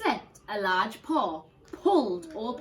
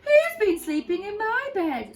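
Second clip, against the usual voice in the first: much higher, indignant mother bear; Mummy Bear; 11 semitones higher, 8 dB louder than usual. Acted. Mummy Bear finding her chair moved, high and indignant. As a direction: ask it in a high startled voice. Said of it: "said Mummy Bear".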